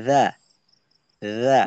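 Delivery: রা said with the tongue tip on the upper front teeth. Shown as a demonstration. Teacher modelling রা for the student. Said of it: "উচ্চারণটা এইভাবে হয়".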